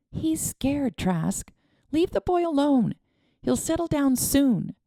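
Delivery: put-on calm the man down for the boy; woman Nadine. A narrator's reading.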